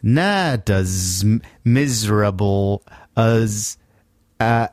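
slow, laboured attempt at a New York accent; halting, badly faked New York accent; phonetic New York accent. Shown as a demonstration. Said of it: "make you sound like you're from New York".

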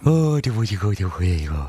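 put-on cooing baby talk to a cat. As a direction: coo at the cat. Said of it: "Is that your cat voice?".

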